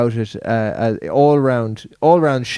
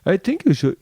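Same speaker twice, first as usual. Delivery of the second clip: put-on higher-pitched, botched Yoruba accent sliding into Indian; failed Yoruba accent, pitched up; attempted Yoruba accent; 7 semitones higher than usual. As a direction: try Yoruba, land near Indian. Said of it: "I was trying to do Yoruba".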